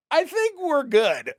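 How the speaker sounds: breezy dismissal. Retold or imitated. Retold brushing off guard.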